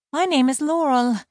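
high-voiced mermaid introducing herself; mermaid Laurel.

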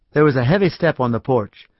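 usual speaking voice

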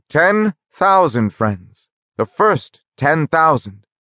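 solemn announcement. A narrator's reading. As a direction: announce it like a milestone, very slowly. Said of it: "announced with much solemnity".

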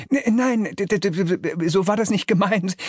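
high, stuttering denial; defensive. A narrator's reading. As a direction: back off with a nervous stutter. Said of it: "sagt Fluxus abwehrend".

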